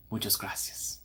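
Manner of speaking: soft whispered "Muchas gracias"; a hushed aside; whispered thanks. Pretend. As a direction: whisper it sheepishly. Thanking the imagined locals.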